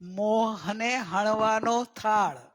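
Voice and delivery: મોહને હણવાનો થાળ very slow, stress on હણવાનો; slow, with હણવાનો drawn out and stressed; word split apart. Shown as a demonstration. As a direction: spell out the meaning slowly, leaning on હણવાનો. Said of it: "બહુ સરસ શબ્દ છે એનો".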